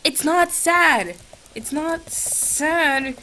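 high, slow exasperated scolding; high drawn-out frustrated scolding. Real, not acted. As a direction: drag each word out in disbelief. Scolding the chatbot.

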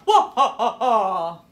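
loud, booming evil villain laugh. A narrator's reading. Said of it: "With one evil laugh".

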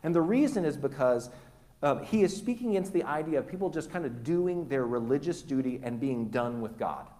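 usual speaking voice

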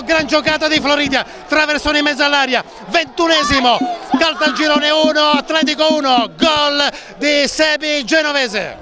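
loud, high goal scream; breathless italian goal commentary. Real, not acted. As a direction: yell the goal at full force. Equaliser called live.

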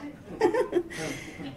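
bright high laugh at her own joke; short amused laugh. Real, not acted. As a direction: laugh it off lightly.

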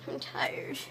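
whispered tired aside. Real, not acted. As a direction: breathe it out in a whisper.

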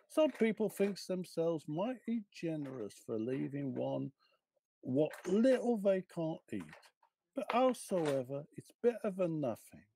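Cockney washerwoman complaining about the stingy; grumbling cockney. Acted. Mrs Watson grumbles over the leftover ham, in a Cockney accent. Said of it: "can you please read for us in your best cockney accent".

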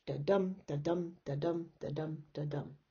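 five "da-dum" beats, weak "da", strong "dum", trailing down; iambic beat. Shown as a demonstration. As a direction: tap out each "da-dum" like a heartbeat. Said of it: "you can see the stressed and unstressed syllables".

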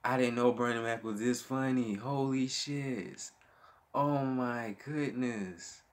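real, blown-away reaction; amazed disbelief. Real, not acted. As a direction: stretch the exclamations out in awe.

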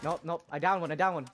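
loud, triumphant callout; excited shout. Real, not acted. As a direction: yell it out in victory. Downing enemy mid firefight.

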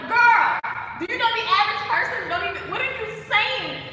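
loud, high, incredulous scolding; exasperated outburst. Retold or imitated. Woman setting her wealthy friend straight, loud exasperation.

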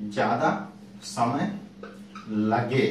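ज्यादा, समय, लगे each said alone with pauses between; slow dictation pace for students writing; word-by-word dictation. Shown as a demonstration. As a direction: spell out ज्यादा समय लगे slowly for note-taking. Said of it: "लिख लीजिएगा आप लोग भी".

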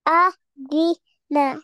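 "Adina" slowly as a, di, na with gaps; learner's slow, separated "Adina"; syllable by syllable. Shown as a demonstration. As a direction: spell out "Adina" one syllable at a time. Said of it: "read it alphabet by alphabet".

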